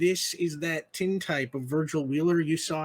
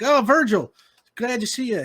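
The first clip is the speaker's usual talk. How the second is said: a higher, louder happy saloon keeper; eager greeting; 7 semitones higher, 8 dB louder than usual. Acted. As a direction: call out in delight to an old friend.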